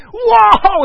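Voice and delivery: loud, high joyful cry of "Wow"; excited shout. Acted. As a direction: burst out in delight at the money. Seeing twenty million in account.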